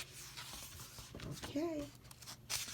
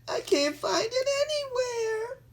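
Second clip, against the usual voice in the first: put-on very high, louder crying kid; 6 dB louder than usual. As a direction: wail in despair. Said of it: "He says".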